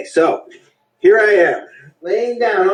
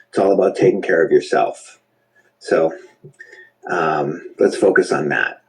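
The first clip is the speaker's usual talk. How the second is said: lower, calm meditation voice; guided meditation; 8 semitones lower than usual. Acted. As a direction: guide them into stillness, gently. Guided relaxation in low voice.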